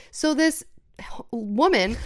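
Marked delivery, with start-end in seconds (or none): 1.0-1.1 whispering. Real, not acted. Speaker whispers a near-slur.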